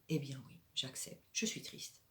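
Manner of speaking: whispered, resigned acceptance; quiet acceptance. Shown as a demonstration. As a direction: whisper it, letting the sadness be. Demo of accepting sadness. (chuchoté, résigné, elle accepte sa tristesse)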